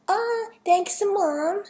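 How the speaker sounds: put-on very high Dutch girl voice; high-pitched, hammy Dutch accent; attempted Dutch accent. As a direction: thank mom in a girlish Dutch accent. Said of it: "sorry for my awful accent".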